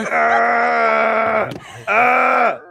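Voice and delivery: long, high, loud scream; loud wail of revulsion; anguished scream. Real, not acted. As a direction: scream to drown out the clip. Reacting in horror to a barking clip.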